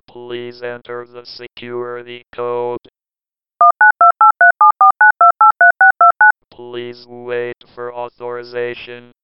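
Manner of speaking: low, slow machine announcer; security system. Acted. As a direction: speak as a door lock computer.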